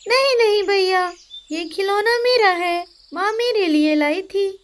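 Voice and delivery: put-on high pleading girl's voice; a small girl protesting her toy is hers; little girl voice. Little sister pleading with her brother, high childish voice.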